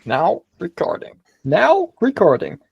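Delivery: high, stretched American robot call; American bot accent. Acted. Mimicking the Discord bot at recording start, American accent. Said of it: "in, like, a really American accent".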